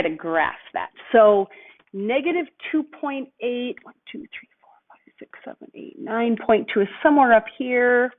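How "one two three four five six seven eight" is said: counting under breath. Real, not acted. Counting grid squares to herself.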